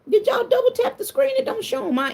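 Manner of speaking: squeaky mock-plead to the viewers; high coaxing question. Real, not acted. Streamer begging viewers to tap like, high and playful.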